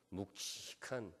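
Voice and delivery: 묵직한 slow, low and hushed; weighty emphasis. Real, not acted. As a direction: stretch 묵직한 out softly as if heavy. Acts out weighty word in blessing. (묵직한을 낮고 느리게 끌어서)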